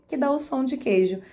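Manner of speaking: usual speaking voice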